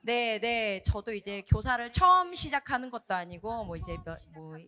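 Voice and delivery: ordinary talk, nothing marked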